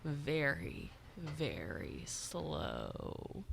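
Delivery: a touch of Georgia drawl; slow drawl. Shown as a demonstration. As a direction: stretch very slow way out. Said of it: "people in Georgia talk".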